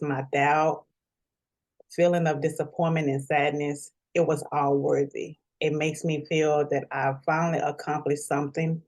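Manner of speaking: usual speaking voice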